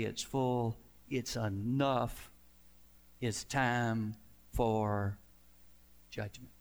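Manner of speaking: God's solemn verdict. Acted. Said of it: "God will say".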